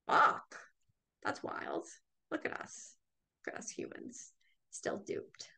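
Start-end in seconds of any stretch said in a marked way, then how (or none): 1.2-5.5 hushed whispered aside. Real, not acted. Marveling at duped humans in a whisper. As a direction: breathe it in disbelief.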